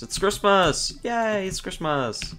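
excited. Shown as a demonstration. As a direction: announce Christmas with glee. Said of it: "Yo, it's Christmas".

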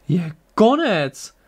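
slow, high-swooping astonished cry; surprised call. A narrator's reading. Torat sees the attack stop, calling out surprised. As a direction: call out in drawn-out amazement. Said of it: "zavolal překvapeně Torat".

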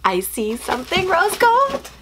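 gasping, high and delighted; excited gasp. Real, not acted. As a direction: gasp at the rose gold find, voice jumping up. Unboxing a longed-for item.

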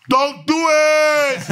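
long, high, loud wail of warning; drawn-out warning yell. Retold or imitated. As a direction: stretch it out and shout the warning. Yelling warning to inmates.

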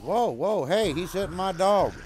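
yelling at Bob for hitting his dog; angry shout. Real, not acted. NPC attacks his dog.

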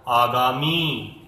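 आगामी said slowly, final मी held long; slow teacher demo of आगामी with long ई; long ii sound. Shown as a demonstration. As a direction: stretch out the मी in आगामी. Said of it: "तो इसको थोड़ा दीर्घ स्वर में बोला जाता है".

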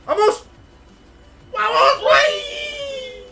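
very high drawn-out screaming cheer; ecstatic goal scream. Real, not acted. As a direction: scream it as the ball goes in. Celebrating a goal on stream.

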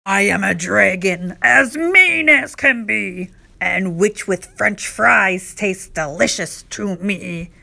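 menacing dragon character voice; scary roaring dragon. A narrator's reading. Dragon threatens to eat the witch, scary roar. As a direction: roar it like a mean dragon, stretching the words. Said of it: "a roar that was scary and loud".

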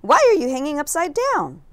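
high, loud curious bat voice; bat character. A narrator's reading. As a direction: call up to the strange bird-bat loudly. A bat voice questions Stellaluna.

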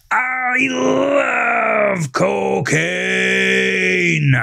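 high, very slow shouted cry, drawn out. A narrator's reading. Melvin shouts again from the car, very slow high cry. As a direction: bellow it even bigger, arms pushing the roof. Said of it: "repeated the statement louder".

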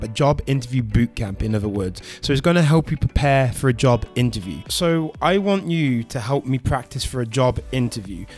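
ordinary delivery

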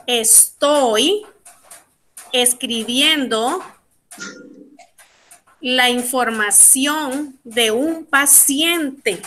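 slow phrases with long pauses; slow dictation. Shown as a demonstration. Teacher gives class a sentence about a patient, slowly dictated. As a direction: dictate it piece by piece. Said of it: "Si yo quiero decir".

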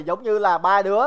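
usual speaking voice

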